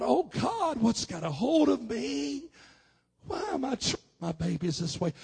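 high, loud plea to God; anguished outcry. Retold or imitated. Father who has just slapped his boy, anguished outcry. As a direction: wail it out in retold self-horror. Retold horror at his own cruelty.

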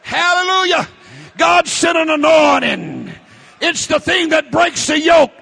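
loud, high preacher's cry; full-force revival preaching; fiery preaching. Real, not acted. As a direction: preach it hard to the congregation.

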